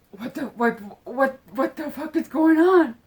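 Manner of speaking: stammering, high and loud mock outburst; loud shock. Acting out the king as he turns around, loud shock. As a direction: stumble on it, then burst out loud and high.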